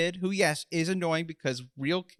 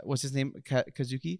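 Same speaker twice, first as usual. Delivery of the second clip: ordinary delivery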